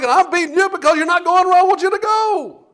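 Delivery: high, loud, put-on voice of a furious rider; angry Balaam. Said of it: "He's like".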